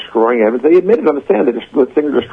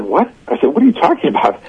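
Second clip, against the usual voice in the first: high-pitched incredulous retort; taken-aback retort, retold; retold indignant disbelief; 9 semitones higher than usual. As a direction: replay the disbelief, pitch jumping up. Retells his indignant retort to prosecutor.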